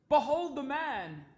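high, loud, slow announcement; loud proclamation. A narrator's reading. Pilate presents Jesus to the crowd, loud proclamation. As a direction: present him grandly to a crowd.

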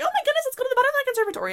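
high, fast, mock-excited mom voice; gushing mom. Daughter mimics mom proposing the Butterfly Conservatory, gushing. Mom's gushing suggestion, acted out.

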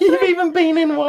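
high, loud, laughing repeat; laughing hard. Real, not acted. Teasing her about her X-Men role, laughing hard. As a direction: crack up while teasing her again.

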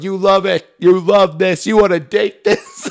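high, loud taunt; punchy, hammed-up heel boasting; defiant talk-show villain. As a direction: goad a booing crowd. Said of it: "And he's going".

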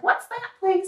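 put-on high, nosy old lady; little old lady voice. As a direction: peer in like a curious granny. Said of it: "I love the little old lady voice".

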